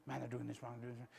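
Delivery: low, soft muttering to oneself; quick, hushed inner grumbling; nervous private thoughts. Retold or imitated. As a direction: replay anxious thoughts under your breath. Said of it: "I'd think about all the things".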